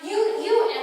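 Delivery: high, loud sputter on a repeated word; exasperated stammer. Retold or imitated. Birdie cut short by Lionel, exasperated stammer. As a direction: sputter in exasperation, unable to get going. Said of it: "exasperated, stammers".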